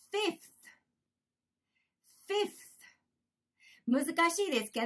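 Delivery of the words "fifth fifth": "fifth" carefully with f then a clear th; final th sounded. Shown as a demonstration. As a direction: model "fifth" as a separate word, th at the end. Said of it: "で、最後にth". (最後のthまで「fifth」をはっきり発音)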